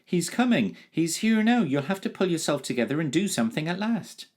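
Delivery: put-on boy urging the dragon to act, fast high shout; breathless shout. A narrator's reading. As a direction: urge him to act, fast and loud. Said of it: "he shouted".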